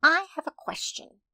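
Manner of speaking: put-on high-pitched child's call. A narrator's reading. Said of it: "Maya called".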